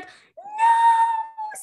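high, loud shouted no; emphatic no. Retold or imitated. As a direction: yell the no out, outraged. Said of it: "And I was like".

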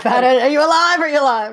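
high, loud mock panic; alarmed fiancee. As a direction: imitate an anxious wake-up check. Fiancee's anxious checks, retold by daughter.

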